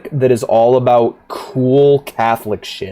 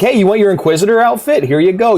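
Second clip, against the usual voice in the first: shop clerk; 8 semitones higher than usual. Retold or imitated.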